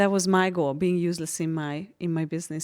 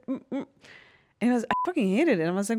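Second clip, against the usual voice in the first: ordinary talk, nothing marked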